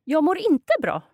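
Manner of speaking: Jag mår inte bra with stress on inte; Swedish model phrase. Shown as a demonstration. Teacher models Jag mår inte bra for learner. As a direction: sing the line with inte on top. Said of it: "So you can say".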